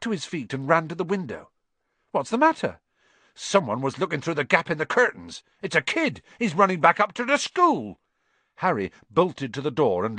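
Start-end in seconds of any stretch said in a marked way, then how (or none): 2.1-2.8 high, louder, startled question; alarmed question. Acted. Friend sees Hagrid leap up, alarmed question. 3.4-8.0 high, urgent raised voice; alarmed. Acted. Hagrid spots a kid spying through the curtains, alarmed.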